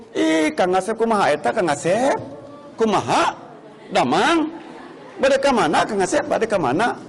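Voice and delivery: gentle Sundanese scolding with rising ends; soft Sundanese. Retold or imitated. Sundanese friend scolding Kang Asep, softly lilting. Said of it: "Sunda itu marahnya pun lemah lembut".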